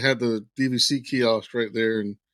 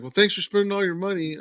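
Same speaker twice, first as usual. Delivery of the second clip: sarcastic salesman; 8 semitones higher than usual. Retold or imitated. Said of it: "the guy was just like".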